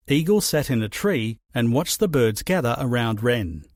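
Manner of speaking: ordinary delivery, nothing marked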